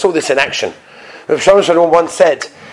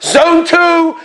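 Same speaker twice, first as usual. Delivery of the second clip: high, proud boarding call; mock-grand gate announcement, pitched up; self-important announcer; 11 semitones higher, much slower than usual. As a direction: imitate an airport official relishing her power. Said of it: "she held herself to be like the president".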